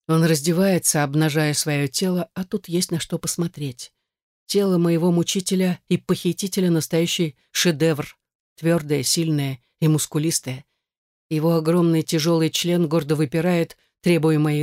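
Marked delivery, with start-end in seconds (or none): none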